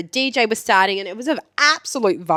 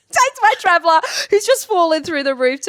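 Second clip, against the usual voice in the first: high, loud, incredulous retelling through laughter; laughing disbelief; 9 semitones higher, 4 dB louder than usual. Real, not acted. Tour leader amazed the manager takes him for a shot, laughing. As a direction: blurt it out high, cracking up.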